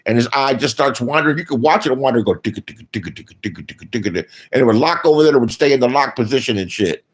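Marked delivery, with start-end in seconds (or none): 2.4-4.3 low, soft ticka ticka; quiet mechanical ticking noise; ticking eye sound. Retold or imitated. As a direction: mimic the eye clicking sideways. Eye wandering sound effect.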